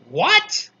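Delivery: very high, loud outraged shriek; piercing rising yelp of outrage; screeched. A narrator's reading. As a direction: screech it in disbelief. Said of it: "she screeched".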